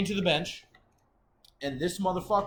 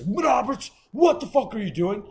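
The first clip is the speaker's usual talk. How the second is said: loud, higher imitated officer's shout; officer yelling; 8 semitones higher, 8 dB louder than usual. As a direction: yell it like an angry guard calling out an inmate.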